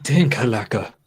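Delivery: put-on punchy, aggressive German accent. Host showing how to say the beer name, in a German accent. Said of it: "I said it in a German".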